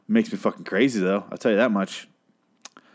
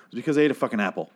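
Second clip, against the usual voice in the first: ordinary talk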